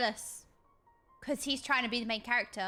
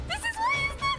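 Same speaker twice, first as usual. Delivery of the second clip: squeaky fangirl shriek; high squeal; 4 dB louder than usual. Real, not acted. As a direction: squeal it far above normal. Squealing repeat, cut off.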